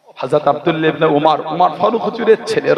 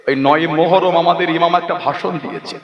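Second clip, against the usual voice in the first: ordinary talk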